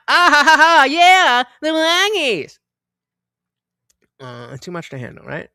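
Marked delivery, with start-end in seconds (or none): 0.1-2.6 mock chuckling approval.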